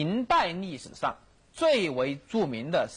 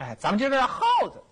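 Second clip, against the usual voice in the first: higher, quicker, stubborn challenge; acted defiant standoff; 6 semitones higher, 4 dB louder, faster than usual. Emperor's mother stalls outside the capital, defiant. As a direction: play a mother who will not budge.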